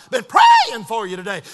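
loud, whooping voicemail preacher; phone message impression. Preacher repeats a friend's encouraging message, loud whooping impression. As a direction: mimic a hollering preacher, leaping on key words. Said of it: "That's just the way it sounded".

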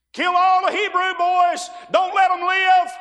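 high, loud barked orders; Pharaoh yelling. Retold or imitated. Preacher voicing Pharaoh again, loud yelling. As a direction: roar it like a raging king. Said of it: "He just yells and yells and yells".

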